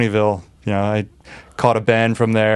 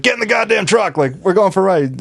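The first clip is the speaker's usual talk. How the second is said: mock demand; 8 semitones higher than usual. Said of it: "I was like".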